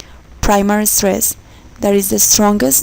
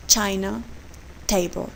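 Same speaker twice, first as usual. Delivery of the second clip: strong first syllable in "China" and "table"; "China", "table", each leaning on its first syllable; first-syllable stress; 7 dB quieter than usual. Shown as a demonstration. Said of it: "the stress is on the first syllable".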